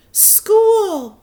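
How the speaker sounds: high, loud thrilled shout; excited shout. Acted. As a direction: call it out bursting with joy. Girl joyfully runs to school.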